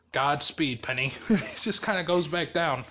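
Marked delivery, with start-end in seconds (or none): 0.1-1.1 low, softened farewell; deadpan send-off. Retold or imitated. Izuku hurling a penny into space, low and dry. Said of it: "And he's like".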